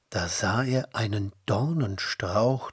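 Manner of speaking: plain reading voice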